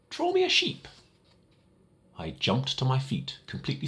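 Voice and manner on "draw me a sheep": put-on high, small child's voice asking politely; a little boy's odd, earnest request; high little prince voice, gentle plea.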